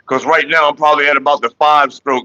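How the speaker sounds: ordinary talk, nothing marked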